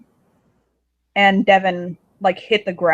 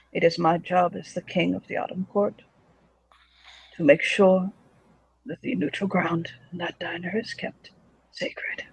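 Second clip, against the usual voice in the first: soft, measured king stating his duty; hushed, formal ruler's voice; solemn fae king; 7 dB quieter than usual. Acted. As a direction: speak softly as a king, fading to a whisper.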